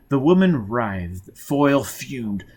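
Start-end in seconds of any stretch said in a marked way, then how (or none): none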